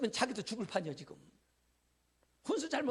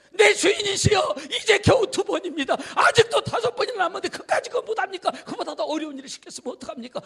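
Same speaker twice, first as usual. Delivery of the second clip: loud, high, laughing plea; pleading shout; 8 semitones higher, 11 dB louder than usual. Acted. Servant begging angry Naaman to try again, loud pleading. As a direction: beg the master to keep going.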